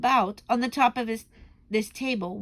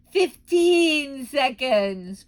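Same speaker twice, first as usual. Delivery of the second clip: slow, very loud countdown cry; booming witch countdown; 5 semitones higher, 8 dB louder, much slower than usual. Acted. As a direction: call the time out gleefully. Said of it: "cried the Grand High Witch".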